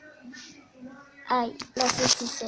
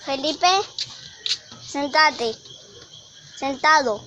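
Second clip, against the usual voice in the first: firm dog commands; 2 semitones higher, 9 dB louder than usual. Real, not acted.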